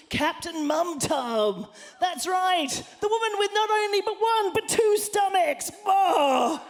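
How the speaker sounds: high, booming mock-heroic proclamation; superhero announcer. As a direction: proclaim it like a superhero's grand intro. Announces own made-up superhero persona.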